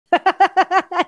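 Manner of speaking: loud, high-pitched burst of laughter; real laugh, let out proudly; unapologetic laugh. Real, not acted. Speaker showing she doesn't care if she laughs, unapologetic laughing. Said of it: "I don't care if I laugh".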